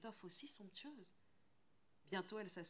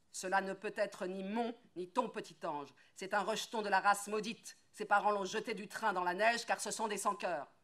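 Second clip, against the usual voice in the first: louder, hard and insistent; angry refusal; 3 semitones higher, 10 dB louder than usual. Acted. Woodcutter angrily refusing to keep the child. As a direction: lay down the law to her.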